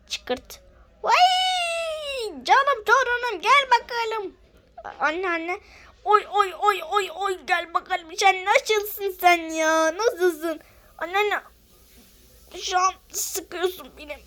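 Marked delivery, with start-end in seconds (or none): 1.0-10.6 high, loud granny greeting her grandchild; granny cooing at the door; doting grandma. Acted. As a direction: gush over the grandchild like an old granny. Speaker plays doting grandma.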